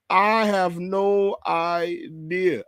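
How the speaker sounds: slow, stretched-out I have no idea; drawn out. Real, not acted. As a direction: drag each word out in disbelief. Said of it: "drawn-out puzzled reply".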